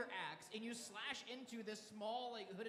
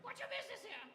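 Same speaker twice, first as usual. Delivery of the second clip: very high parroting bird voice; kenku mimicry; 12 semitones higher, faster than usual. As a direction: parrot it back in a high squawk. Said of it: "but also a bird-like creature".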